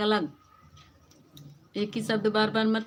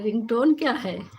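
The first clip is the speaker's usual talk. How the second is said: amused laugh; 3 semitones higher, 5 dB louder than usual. Real, not acted. Laughing at her own ringtone slip to viewers. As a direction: giggle at the silly word.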